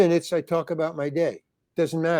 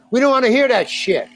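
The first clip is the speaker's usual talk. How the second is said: imitating an angry heckler, higher and louder; mock heckler yell; 8 semitones higher, 4 dB louder than usual. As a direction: throw it out like a drunk heckler. Heckler with bottle yells at comedian.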